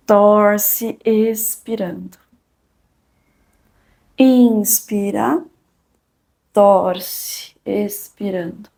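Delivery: drawn-out, calm yoga instructor cues; slow breathing cues. Acted. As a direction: stretch each cue over the breath.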